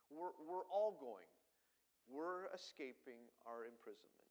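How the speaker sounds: usual speaking voice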